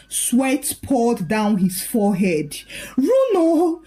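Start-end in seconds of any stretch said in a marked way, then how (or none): 3.0-3.8 wife screaming at her fallen husband. Acted. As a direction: scream his name in panic. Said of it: "she screamed".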